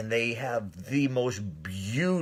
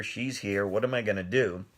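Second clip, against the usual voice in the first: ordinary talk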